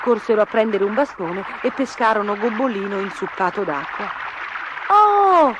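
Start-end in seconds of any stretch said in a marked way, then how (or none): 4.9-5.5 high, loud gasp of delight; surprised cry. A narrator's reading. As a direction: exclaim like astonished children. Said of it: "gridarono in coro".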